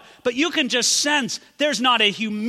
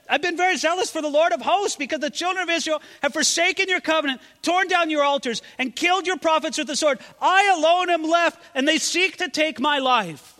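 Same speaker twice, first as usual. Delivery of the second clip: higher, strained lament; despairing Elijah; 9 semitones higher, 3 dB louder than usual. Acted. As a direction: pour out the complaint in anguish. Elijah's complaint voiced.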